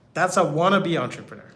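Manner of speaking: usual speaking voice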